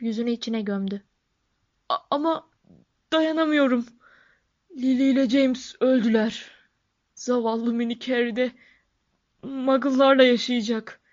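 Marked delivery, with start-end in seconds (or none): 1.9-11.0 acted sobbing. A narrator's reading. Giant grieving for Lily and James, acted sobbing. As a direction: wail it through tears, in character. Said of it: "diye hıçkırdı".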